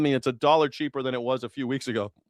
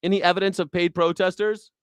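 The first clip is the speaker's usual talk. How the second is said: usual speaking voice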